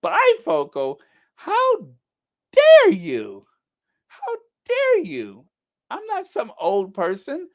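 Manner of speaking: high, squealing mock outrage; an offended lady squealing high at the bifocals. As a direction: act scandalized in a falsetto shriek. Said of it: "I'm like".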